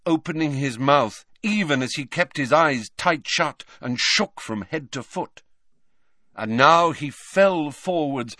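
ordinary delivery, nothing marked

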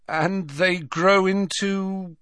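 high, slow, wary question left hanging; apprehensive Ron. A narrator's reading. Ron asking Hagrid about giant grubs, apprehensive. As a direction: ask warily, drawing it out. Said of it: "looking apprehensive".

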